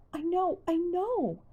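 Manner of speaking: high, slow moan of distress. A narrator's reading. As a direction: drag it out in a pained moan. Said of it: "Connie moaned".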